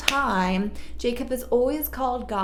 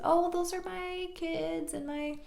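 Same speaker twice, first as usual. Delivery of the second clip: high, soft, slow explanation; mock sheepish; 4 semitones higher, 6 dB quieter than usual. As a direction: draw it out softly, starting high. Jacob sheepishly shows off his family.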